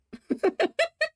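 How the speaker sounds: shrill squeal of a laugh; high squealing laugh. Real, not acted. Streamer laughing at her friend wanting the tattoo, high squeal.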